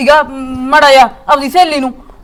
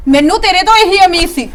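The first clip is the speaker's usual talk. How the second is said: usual speaking voice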